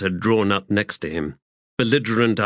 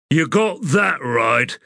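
growl; 6 semitones higher than usual. A narrator's reading. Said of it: "Stormy growled".

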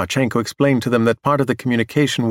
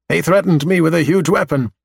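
higher, louder, insistent defense; assertive; 6 semitones higher, 4 dB louder than usual. Acted. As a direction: stand your ground firmly. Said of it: "his voice already sounds much more assertive".

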